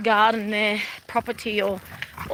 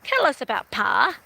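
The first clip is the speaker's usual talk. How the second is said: imitating a Western country accent, higher and louder; Western drawl; 6 semitones higher, 4 dB louder than usual. Daughters quizzing their stage mother, mock Western accent. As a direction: ask about Pa in a hammed-up Western twang. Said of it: "really doing the accent so well".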